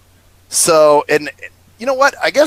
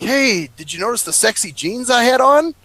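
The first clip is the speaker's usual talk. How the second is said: higher-pitched imitation of a flirty woman; mock flirty, voice pitched up; flirtatious; 8 semitones higher than usual. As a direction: retell her come-on with a playful lilt. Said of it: "a little bit flirtatious".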